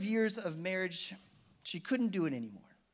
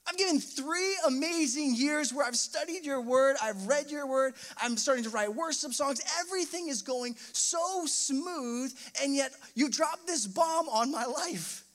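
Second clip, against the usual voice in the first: raised, higher plea that slows at the end; anguished prayer to God; 7 semitones higher than usual. Retold or imitated. Young man crying out to God after the divorce news, anguished. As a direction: accuse God, hurt and bewildered. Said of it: "I remember leaving that conversation going".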